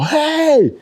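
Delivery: high drawn-out holler for attention; retold yell. Man flagging down a cop, yelling. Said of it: "I start yelling".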